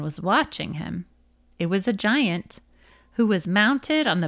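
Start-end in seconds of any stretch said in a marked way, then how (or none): none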